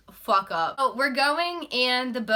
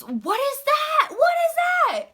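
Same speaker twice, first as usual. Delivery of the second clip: imitated panicked yell, pitched way up; retold scream; 4 dB louder than usual. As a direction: shriek it like an alarmed passenger. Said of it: "someone screams".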